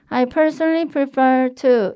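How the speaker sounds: unprojected wrong-way demo, drawn out; bad example. Shown as a demonstration. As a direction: demonstrate weak English vocal placement. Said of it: "제가 안 되는 예시 먼저 설명드릴게요".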